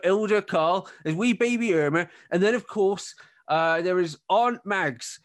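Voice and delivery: farmer host. Acted. Farmer introduces his family to arriving guests, lightly put-on voice. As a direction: play a chatty farmer showing guests round. Game master plays farmer Luther.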